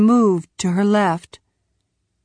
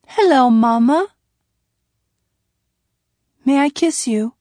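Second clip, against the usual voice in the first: put-on higher-pitched small child voice; sweet child's voice, pitched up; little boy; 4 semitones higher than usual. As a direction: voice a little boy greeting his mama. Little boy greeting his mother.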